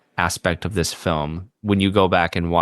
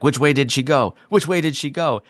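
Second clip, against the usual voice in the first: higher-pitched imitation of urgent demands; mock-urgent questioning, raised in pitch; 7 semitones higher than usual. Retelling a boss pressing his psychic in the sewers, mock urgent.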